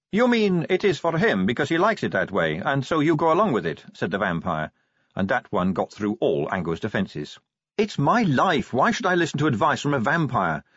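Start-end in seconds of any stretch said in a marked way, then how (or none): 0.1-3.8 high-pitched pointed challenge; Sally, probing vampire. Acted. 7.8-10.6 higher-pitched indignant retort; Angua, defensive werewolf. Acted.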